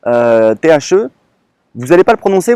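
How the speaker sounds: normal talking voice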